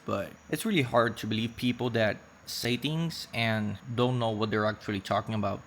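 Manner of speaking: ordinary talk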